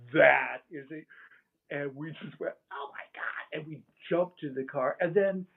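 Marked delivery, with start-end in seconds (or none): 2.7-3.6 hushed, high, airy exclamation; mock shocked gasp. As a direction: gasp it out softly, imitating alarm. Retelling friends' shocked reaction to UFO.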